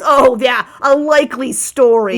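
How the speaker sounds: loud sarcastic disbelief; dude-voiced bird. Bird doubting the party can find Zybilna, loudly scornful. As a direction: scoff as an indignant bird. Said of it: "I have to do a dude voice".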